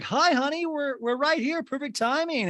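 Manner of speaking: high-pitched mock greeting; Kyle. Podcaster mimicking Kyle greeting her husband, high mock chirp. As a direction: chirp it as a put-on wife.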